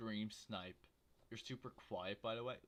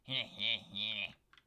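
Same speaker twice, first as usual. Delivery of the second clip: louder breathy laugh; 6 dB louder than usual. Real, not acted. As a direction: laugh it off at himself. Laughing at deforested world.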